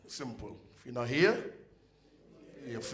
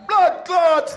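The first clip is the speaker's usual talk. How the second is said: much higher, much louder Jamaican shout; dominoes shout; 15 dB louder than usual. Retold or imitated. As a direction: bellow it as you slam a domino. Uncles slamming dominoes.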